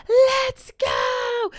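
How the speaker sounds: very high, loud mock yell; mom screaming. Mom calling the kids back from the rocks, shrill mock yell. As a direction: holler it like an impatient mom. Mom rushing them again.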